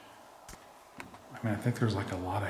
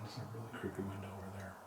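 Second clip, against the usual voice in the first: whispering; 13 dB quieter than usual. Real, not acted. Creepy window, hushed.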